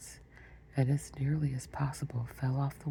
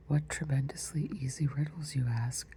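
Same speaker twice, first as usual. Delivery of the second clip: ordinary delivery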